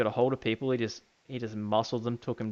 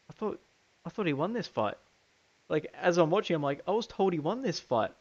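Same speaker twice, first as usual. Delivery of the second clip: surprised; 7 semitones higher than usual. Retold or imitated.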